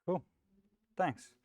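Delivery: ordinary talk, nothing marked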